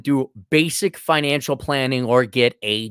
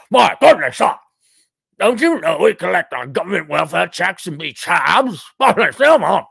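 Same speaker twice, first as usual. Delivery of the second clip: mock British accent; 4 dB louder than usual. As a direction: sneer like a caricature Brit. Mocking Brits about welfare.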